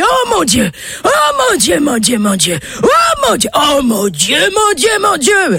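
high, strained repeated yells; yelling oh my God. Acted. Old man yelling at the beach girl in high cries. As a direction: cry out over and over in a frenzy. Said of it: "il se met à hurler".

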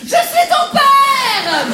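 drawn-out shout in a high squeaky voice; Darth Vader line. Pretend. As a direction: try to boom like Vader and squeak instead. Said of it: "pour doubler Dark Vador". (Dark Vador raté, crié lentement d'une voix très aiguë)

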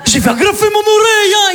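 high unhinged yell; hammed-up lunatic; crazy neighbour. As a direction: rant like a madman. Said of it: "je fais".

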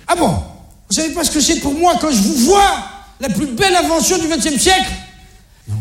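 put-on dad insulting his kids; mock angry. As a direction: build up and snap the insult. Rising to angry punchline.

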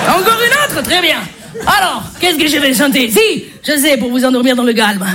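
put-on babysitter pondering in German-accented French. Babysitter choosing a song to calm children, German accent. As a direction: think aloud in a thick German accent. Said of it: "Humour germanique".

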